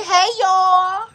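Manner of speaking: high, loud sing-song hey y'all; demo greeting for baby. Shown as a demonstration. Mom showing baby how to greet viewers, loud and high. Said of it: "Say it. Say".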